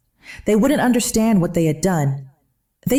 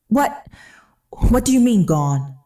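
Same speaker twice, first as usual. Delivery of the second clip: plain reading voice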